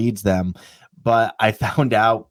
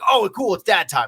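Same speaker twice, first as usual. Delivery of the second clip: eager dog; 7 semitones higher than usual. Acted.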